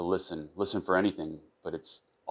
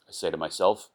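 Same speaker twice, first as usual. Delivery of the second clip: plain reading voice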